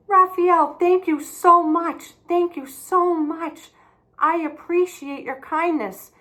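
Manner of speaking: put-on a girl overflowing with thanks to the boy; grateful girl.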